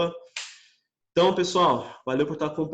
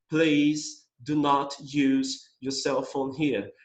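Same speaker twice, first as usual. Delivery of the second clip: slow, deliberate English read; slow model reading; much slower than usual. Shown as a demonstration. Teacher reading sign slowly to class. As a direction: spell it out slowly for students. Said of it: "se liga".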